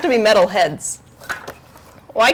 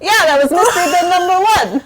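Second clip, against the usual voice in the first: laughing defensively; 7 semitones higher than usual. Real, not acted. Streamer defending the silly bit to the chat, laughing. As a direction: defend the joke while cracking up.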